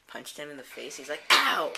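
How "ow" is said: high loud yelp; mock pain yelp. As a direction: cry out like you got punched. Ganon punched in face.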